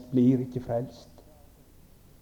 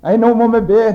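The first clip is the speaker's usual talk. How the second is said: calling out, higher and louder; a frightened man crying out to pray; mock alarmed call; retold cry; 5 semitones higher, 5 dB louder, much faster than usual. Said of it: "roper han".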